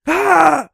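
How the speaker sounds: a high, airy laugh then a loud high ah; high breathy laugh into a shout. Real, not acted. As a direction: burst out laughing and yelp. Reacts to the replay.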